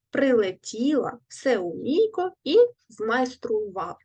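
each word said slowly and separately, stressed syllable marked clearly; careful stress drill. Shown as a demonstration. Teacher modelling word stress for pupils, careful drill. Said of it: "поставимо правильно наголос в цих словах".

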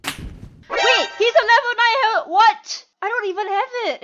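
high, loud real disbelief; shocked outburst. Real, not acted. Gamer seeing a level nine with the item, shocked outburst. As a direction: exclaim in indignant surprise.

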